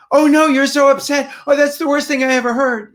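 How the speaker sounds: high, loud, over-the-top distress; hammed-up horror that competes with theirs; too much emotion. Shown as a demonstration. Parent to upset child, overdone high-pitched alarm. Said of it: "You don't want so, as much emotion as they have".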